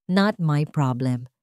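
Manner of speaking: slow and cold, each word laid down; cold, slow dismissal. Acted. Isabel brushing off Emmanuel's plea, slow and cold. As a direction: brush him off with icy calm.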